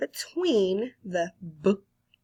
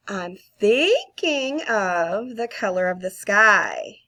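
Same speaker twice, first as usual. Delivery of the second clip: guessing game clue; 6 dB louder than usual. Shown as a demonstration. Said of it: "I'm Thinking of Something".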